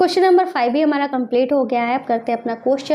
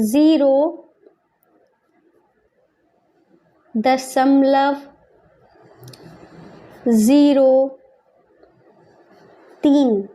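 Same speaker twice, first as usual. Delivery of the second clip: word by word with long pauses; slow dictation; much slower than usual. Shown as a demonstration. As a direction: draw out each word as if writing it. Dictating while writing in words.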